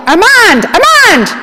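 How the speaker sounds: high, loud calling out across rubble; yelling a name. Retold or imitated. Father calling out to his buried son, loud and high. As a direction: shout his son's name into the debris. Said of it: "He yelled".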